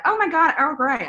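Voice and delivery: high, loud mock American tourist gushing; a bad, hammed-up American accent gushing over the tea; terrible American accent. Baker mocking American tourists at her shop, in a bad American accent. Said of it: "That was a terrible accent".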